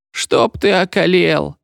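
put-on high, slow tearful old woman's whine; teary, whiny old woman; whimpering curse. A narrator's reading. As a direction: whimper it out like a hurt old lady. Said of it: "захныкала старушка".

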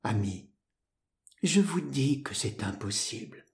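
soft, weary refusal. A narrator's reading. Woman gently refuses her suitor after a kiss, weary. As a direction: let it drop softly, with a touch of lassitude. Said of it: "elle dit avec une sorte de lassitude".

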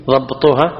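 usual speaking voice

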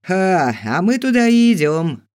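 loud, high, jeering guffaw of a thug; chortling thug. A narrator's reading. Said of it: "хохотнул громила Гвен". (с издевательским хохотком)

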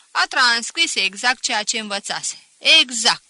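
loud, scornful schoolmaster mocking a pupil; teacher ridiculing the boy to the class; sneering teacher. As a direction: pour scorn on him before the class.

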